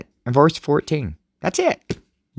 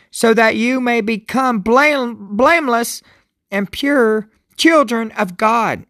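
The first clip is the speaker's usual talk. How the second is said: raised, slowed, solemn Bible reading; scripture reading; 11 semitones higher, slower than usual. Acted. Pastor reading Philippians to his viewers, solemn and slow. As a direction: read each phrase as holy words.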